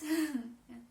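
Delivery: sudden laughing fit; burst of laughter. Real, not acted. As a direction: laugh loudly at yourself. Laughing again.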